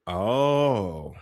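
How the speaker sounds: ordinary delivery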